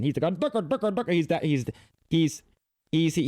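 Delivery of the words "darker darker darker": lightly mocked Indian accent, higher pitch; quick imitation of an Indian accent. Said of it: "red dot Indian".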